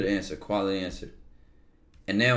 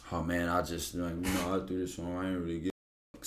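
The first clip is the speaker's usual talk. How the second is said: softer, lower, lazy shrug of a voice; mock careless slacker; 6 semitones lower, 10 dB quieter than usual. Imitating someone who doesn't care, low and soft. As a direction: toss it off like you couldn't care less.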